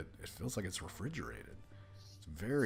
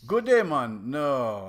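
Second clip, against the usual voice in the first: loud, hearty, higher bartender; 6 semitones higher, 11 dB louder, much slower than usual. Acted. Bartender greets Leon, loud and jolly. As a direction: boom out a jolly barkeep greeting.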